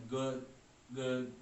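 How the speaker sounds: गुड as one short, separate syllable; one single sound. Shown as a demonstration. Teacher answering a student's objection, गुड in one beat. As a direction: demonstrate गुड in a single beat, then pause. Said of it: "एक ही pronounce हो रहा है".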